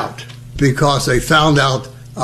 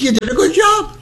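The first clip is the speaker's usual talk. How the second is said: mock dentist praise; 13 semitones higher than usual.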